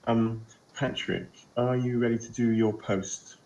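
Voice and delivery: ordinary talk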